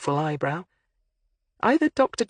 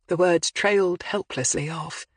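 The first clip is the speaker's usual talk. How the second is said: ordinary delivery, nothing marked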